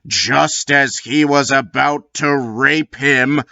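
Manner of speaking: ordinary delivery, nothing marked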